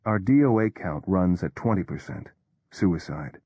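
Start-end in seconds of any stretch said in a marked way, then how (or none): none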